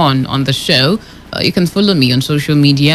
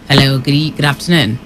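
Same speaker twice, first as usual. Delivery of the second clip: ordinary delivery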